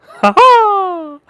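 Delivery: shrill high-pitched ha ha; a goofy squeaky laugh noise; high cackle. Pretend. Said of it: "That was good".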